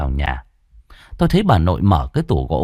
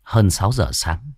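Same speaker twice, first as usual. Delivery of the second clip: ordinary delivery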